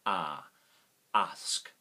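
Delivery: "ah", then "ask" said with a long open "ah"; vowel demo. Shown as a demonstration. Teacher modelling the Australian "ask", open vowel. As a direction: say "ask" as "arsk", open and loud. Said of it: "the A has number three sound".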